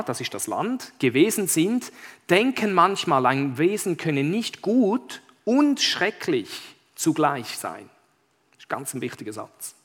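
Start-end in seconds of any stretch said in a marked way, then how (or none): 1.0-7.9 raised, slow, weighty read-aloud; story narration. Acted. Pastor reads a key Narnia sentence, emphatic storytelling. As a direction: draw out the key words of the tale.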